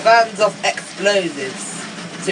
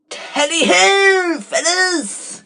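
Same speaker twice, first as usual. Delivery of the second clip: higher, hearty posh Spitfire call; posh British cry; 7 semitones higher, slower than usual. Acted. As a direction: shout it like a dashing RAF pilot. Posh pilot arrives.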